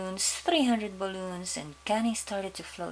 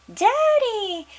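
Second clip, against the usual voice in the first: put-on little girl voice; 8 dB louder than usual. Daughter greets father.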